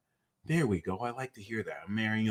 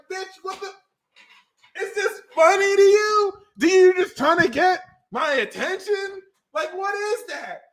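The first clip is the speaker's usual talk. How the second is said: incredulous quote; 13 semitones higher, 3 dB louder than usual. Retold or imitated. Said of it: "And I'm just like".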